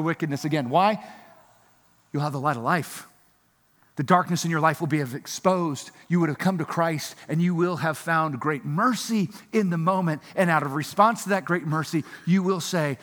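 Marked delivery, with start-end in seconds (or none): none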